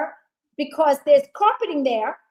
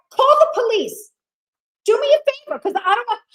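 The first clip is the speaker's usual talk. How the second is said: yelling at manager; 9 semitones higher, 6 dB louder than usual. Retold or imitated. Arguing with restaurant manager over service dog, shouting. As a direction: shout it, pitch climbing high. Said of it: "And now I start to raise my voice".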